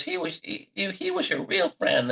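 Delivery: mock mum in a high voice; Howard Stern's mother. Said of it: "And she goes".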